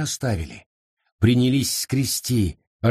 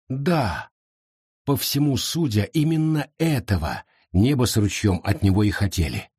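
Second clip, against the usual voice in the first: plain reading voice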